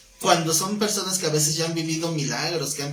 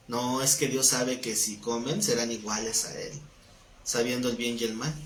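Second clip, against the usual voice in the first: lower, softer, insinuating tempter; low sly serpent; 5 semitones lower, 5 dB quieter than usual. Retold or imitated. The serpent quietly tempting Eve with a lie, sly. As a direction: seduce her quietly with the lie.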